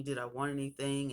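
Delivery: ordinary delivery, nothing marked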